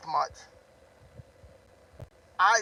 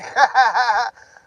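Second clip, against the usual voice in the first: loud laugh; 3 semitones higher, 9 dB louder than usual. Real, not acted. Laughing loudly mid-rant.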